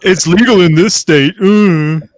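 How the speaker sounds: put-on sly drawl; mock creepy delivery; sly tease voice. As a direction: tease with a knowing hum. Joking innuendo line.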